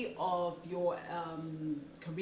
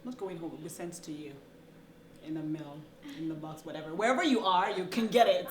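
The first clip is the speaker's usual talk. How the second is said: ordinary talk, nothing marked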